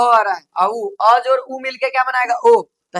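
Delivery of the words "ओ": normal talking voice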